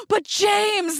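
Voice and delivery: high-pitched wail of disbelief, retold; mock protest. Retelling her disbelieving protest.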